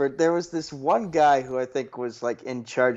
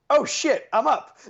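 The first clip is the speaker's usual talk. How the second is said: startled; 13 semitones higher than usual. Retold or imitated. Hears his name called suddenly.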